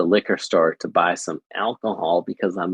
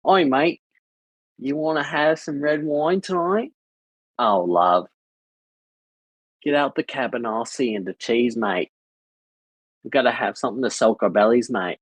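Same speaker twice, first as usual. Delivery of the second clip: playful put-on Aussie mate-speak; mock Australian accent; 3 semitones higher than usual. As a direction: lay on a broad, hammed-up Australian accent. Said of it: "I've picked up the basics".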